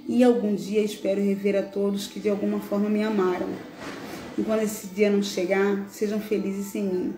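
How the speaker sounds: put-on heartfelt reading of a dead boy's farewell letter; a mournful poem read aloud over soft music; solemn recital. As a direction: recite it gently, as the lost child speaking to his father. Reads a reflection as Bernardo.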